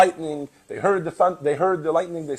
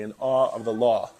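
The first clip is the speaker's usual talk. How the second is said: mock New York accent; 4 semitones lower than usual. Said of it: "they like to make fun of my New York accent".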